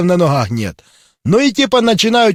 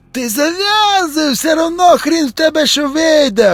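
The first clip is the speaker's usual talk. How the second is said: mock zombie voice; 8 semitones higher than usual. Zombie mocks controller in joke.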